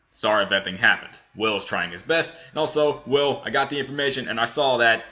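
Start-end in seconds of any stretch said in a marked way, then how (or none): none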